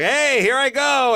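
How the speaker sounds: high, loud, slow show-off; hammy bird about to fly; pelican voice. Retold or imitated. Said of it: "the pelican goes like".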